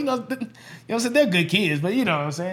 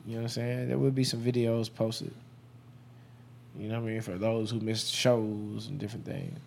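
high and talking low; low, mellow stoner voice; 6 semitones lower, 9 dB quieter than usual. Real, not acted. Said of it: "Excuse me if I'm talking a little low. I'm high right now".

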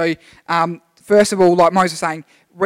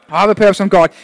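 louder, declared like a vow; congregation-style loud repeat; 7 dB louder, faster than usual. Shown as a demonstration. Congregation repeats the pastor's line, loud and firm. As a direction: proclaim "purpose" firmly. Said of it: "repeat it after me".

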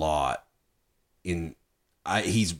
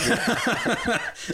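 high burst of laughter; real laugh. Real, not acted. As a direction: crack up at your own impression. Laughing at his own impression.

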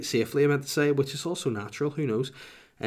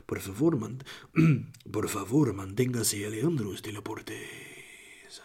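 put-on Spanish voiceover accent. Would-be voiceover guy showing off, mock Spanish accent. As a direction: linger on each name like a Spanish ad. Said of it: "I should just be a Spanish voiceover guy".

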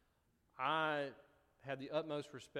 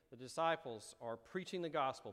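normal talking voice

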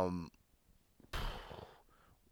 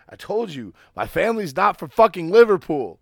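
louder and higher, half-laughing protest; exasperated; 7 semitones higher, 8 dB louder than usual. Real, not acted. Insisting to his friend his family isn't from Liverpool, loud and exasperated. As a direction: throw up your hands and yell it out.

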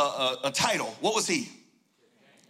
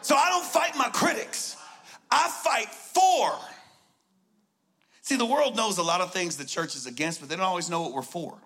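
preaching at full force; 4 semitones higher than usual. Acted. Pastor yelling the sermon to the congregation, full-force preaching. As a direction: preach it, don't teach it. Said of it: "I'm about to preach right now".

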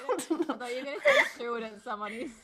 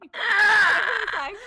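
loud, slow wail through laughter; laugh-crying shout; 8 semitones higher, 11 dB louder, much slower than usual. Real, not acted. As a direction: howl it out, laughing in disbelief.

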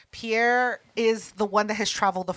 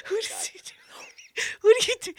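laughing; 12 semitones higher than usual. Real, not acted. Laughing at microphone mix-up.

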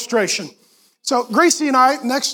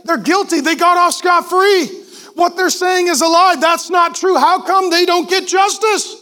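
indignant complaint; 5 semitones higher than usual. Retold or imitated. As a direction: vent about the unfairness. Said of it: "You're like".

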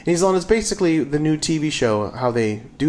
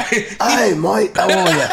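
higher, raspy British, nearly a pirate; 5 semitones higher, much slower than usual. Pretend. Podcaster greeting a pretend mate, raspy British accent. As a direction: ham up a British greeting, laughing. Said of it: "That was almost a pirate".

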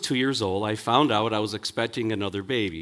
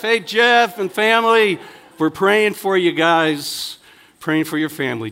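high, loud call to the camera; calling out a greeting; 6 semitones higher, 7 dB louder than usual. Real, not acted. Leading the room in greeting Jeff.

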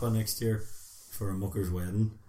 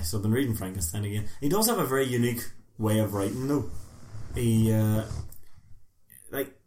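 ordinary talk

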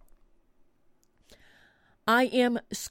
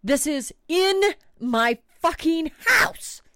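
higher, slightly louder indignation; outraged emphasis; 7 semitones higher, 4 dB louder than usual. Real, not acted. Storyteller stressing the bat is inside her house, outraged. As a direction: hit each word with outrage.

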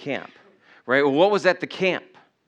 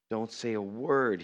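soft, slow, low instruction; God's quiet command; 4 semitones lower, 9 dB quieter, slower than usual. Acted. God telling the believer not to answer back, quiet command. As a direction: say it slowly under your breath. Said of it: "he says".

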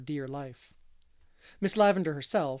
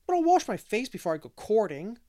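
put-on higher and louder boyish protest; 6 semitones higher, 6 dB louder than usual. Davy protests to Marilla, higher and louder. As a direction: insist he'll wash up first, protesting. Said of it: "protested Davy".

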